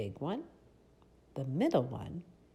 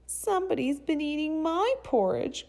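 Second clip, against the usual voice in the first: tiny, very high little bear voice; high, loud, cute cub cry; itty-bitty Baby Bear; 13 semitones higher, 6 dB louder than usual. A narrator's reading. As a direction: squeak it out like an upset baby bear. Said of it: "cried the cute baby bear in his itty-bitty voice".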